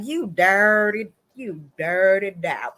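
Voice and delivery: slow, drawn-out disgusted reproach; mock scolding. Vlogger reacts to the cheating husband, slow mock scolding. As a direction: stretch out each word in hammed-up disapproval.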